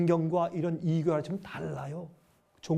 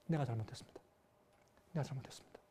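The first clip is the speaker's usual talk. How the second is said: soft, lowered confession; quiet, humbled plea for forgiveness; humble repentance; 4 semitones lower, 13 dB quieter, faster than usual. Acted. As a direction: murmur it, bowed down in shame. Job repenting on his face.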